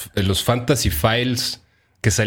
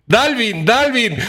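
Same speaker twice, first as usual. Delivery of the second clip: excited; 12 semitones higher, 3 dB louder, slower than usual. Acted. Emphatic answer to the question.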